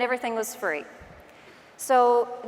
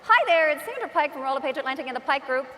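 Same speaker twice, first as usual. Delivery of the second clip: quick cheerful Newfie video intro; Newfoundland accent; 3 semitones higher, faster than usual. Retold or imitated. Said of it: "I sound like a newfie".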